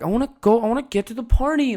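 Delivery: put-on high, pleading whine of an impatient kid; whiny pissy kid. Kid at dinner nagging his mom to leave, whiny. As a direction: beg to leave dinner like a spoiled little prince. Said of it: "very annoying and pissy".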